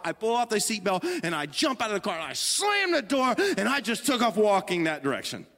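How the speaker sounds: ordinary delivery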